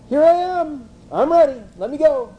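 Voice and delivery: high, keen goody-two-shoes reply; volunteer like an eager teen; eager obedient son. Acted. Joseph answering his father eagerly, keen and high.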